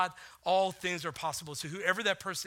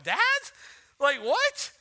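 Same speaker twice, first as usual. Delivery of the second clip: loud, higher, shocked "what?"; shocked child; 9 semitones higher, 10 dB louder, slower than usual. Retold or imitated. Daughter offered a hundred dollars to deny Jesus, shocked. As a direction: react like a startled little girl. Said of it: "she's like".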